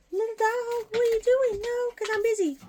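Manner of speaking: sky-high doggy voice. Real, not acted. Squealing at her dog.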